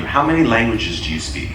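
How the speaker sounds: ordinary delivery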